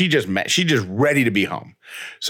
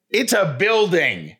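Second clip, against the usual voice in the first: indignant retort; 8 semitones higher, slower than usual. Retold or imitated. Retort to the attendant.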